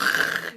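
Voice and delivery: imitated zombie growl; mock movie-zombie noise; clichéd zombie noise. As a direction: make a hammy zombie sound. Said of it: "act like a zombie and be all cliched".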